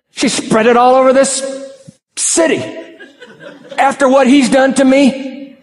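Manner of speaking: indignant refusal. Pretend. A wronged churchgoer protesting to God, high and indignant. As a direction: sputter in disbelief at being asked to forgive.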